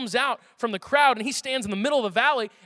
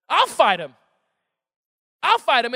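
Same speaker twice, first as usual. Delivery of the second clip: firm, louder, twice over; bold declaration; 5 dB louder than usual. Retold or imitated. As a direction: say it like a boy who fears nothing. David volunteering boldly.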